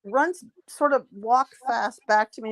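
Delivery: ordinary talk, nothing marked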